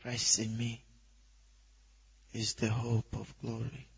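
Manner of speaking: very soft, low recitation, spaced out; meditating in the head. Shown as a demonstration. Preacher demonstrates reciting scripture while meditating, hushed and low. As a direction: keep it inward and hushed. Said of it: "In your head".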